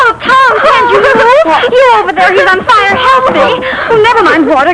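a wife shrieking at her husband to move; urgent high panicked shouting. Acted. Woman panics at burning boy.

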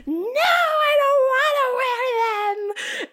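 high, loud whiny screaming refusal; retold toddler scream. Child refusing overalls to her mom, high screamed whine. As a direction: shriek it back like a tantruming kid. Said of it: "I would scream".

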